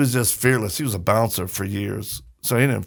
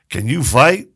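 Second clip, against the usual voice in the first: louder challenge with rising end; blunt challenge; 8 dB louder than usual. Retold or imitated. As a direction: imitate dad challenging a stranger. Dad challenges big stranger.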